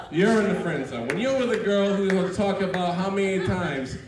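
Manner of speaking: loud, high rowdy delivery; amped-up comic bit. Real, not acted. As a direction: hype the crowd up. Hyped comic set with friend.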